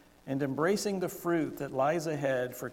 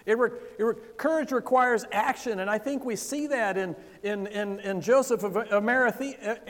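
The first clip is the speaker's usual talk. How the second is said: fervent preaching; 7 semitones higher, 5 dB louder than usual. Acted. Preaching with rising fervor.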